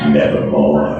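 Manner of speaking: low, dark raven voice dropping into one word; ominous low raven croak; raven's croak. Acted. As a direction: let the bird answer from deep down. Raven answers in poem.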